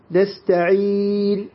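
نستعين with the ending held four counts; medium-length stop. Shown as a demonstration. Teacher showing students the medium-length stop on نستعين. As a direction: hold the end of نستعين at medium length, slowly. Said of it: "هذا هو توسط".

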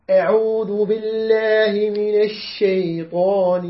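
slow, drawn-out tajweed chant; Quran recitation. Shown as a demonstration. Teacher recites the verse to his class, slow tajweed chant. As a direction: recite the verse slowly with full ghunna.

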